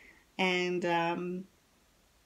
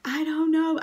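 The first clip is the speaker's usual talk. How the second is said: distressed; 8 semitones higher, 5 dB louder than usual. Retold or imitated. Distressed answer in pain.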